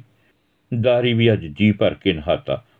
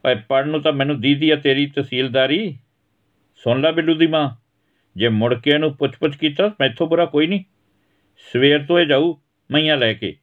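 higher voice, scornful and bossy; gruff father laying down the law; 7 semitones higher than usual. Acted. Father warning his wife about the boy, scornful. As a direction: tell the wife no more coddling.

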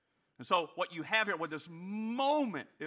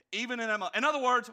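ordinary talk

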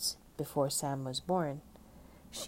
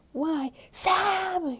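high, loud exasperated yell; pitched way up, big outburst; 8 dB louder than usual. A narrator's reading. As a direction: shout it out, fed up with the questions. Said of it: "yelled Stella".

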